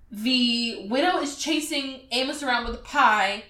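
normal talking voice

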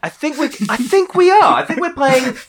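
high, loud, through real laughter; amused, voice pitched right up with laughter; laughing. Real, not acted. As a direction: crack up while getting the words out. Cracks up at the game title.

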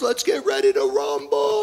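mock ring announcer shout; hammed-up fight MC call; a preacher imitating the tattooed MC shouting to the crowd; boxing announcer. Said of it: "he goes".

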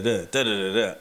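usual speaking voice

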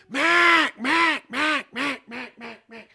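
long loud first quack, then quacks trailing down; standing on the first note. Shown as a demonstration. Caller hailing a flock that is leaving, loud first note. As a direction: hold the first note hard, then let the calls fall away. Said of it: "He would just stand on that first note".

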